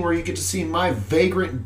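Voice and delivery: ordinary delivery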